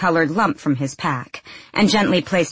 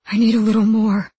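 needy, raised voice of a wounded man; dazed, craving mumble; mumbled plea; 4 semitones higher, 5 dB louder than usual. A narrator's reading. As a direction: plead through a mumble, higher and louder. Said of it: "the Grisha mumbled".

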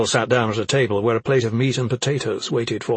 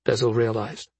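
usual speaking voice

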